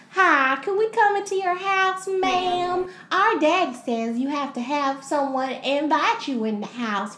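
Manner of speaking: high, loud hillbilly drawl; hammed-up hillbilly twang; hillbilly demons. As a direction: imitate pestering hillbilly youngsters, stretched out. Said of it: "but they're hillbilly demons".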